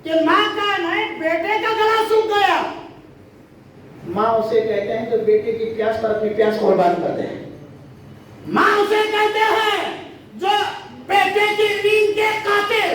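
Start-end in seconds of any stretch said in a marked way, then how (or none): 0.0-2.6 rising preacher's cry. Acted. Preacher raises the voice. 8.5-12.9 shouted fervent sermon on a mother's sacrifice; preaching at full force. Acted.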